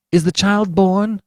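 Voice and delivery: high, loud, slow pleading; father begs the doctor for news; begging. Acted. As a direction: beg for news. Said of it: "begged Mister Button".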